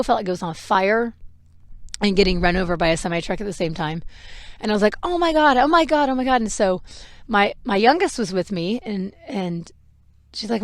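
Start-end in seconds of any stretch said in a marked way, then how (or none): 5.0-6.4 high, urgent exclamation easing off; retold pain panic. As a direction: relive a sudden burst of pain. Reliving sudden shooting toe pain.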